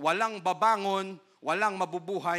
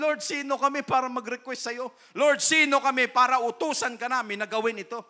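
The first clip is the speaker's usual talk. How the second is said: higher, louder pleading; humble believer; 7 semitones higher, 7 dB louder than usual. Acted. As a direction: protest in awe. Humble prayer voiced.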